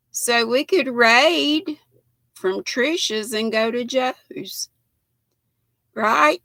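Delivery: hoarse, strained voice going; losing voice. Real, not acted. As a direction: voice giving out, slow. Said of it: "I don't know why I'm losing my voice".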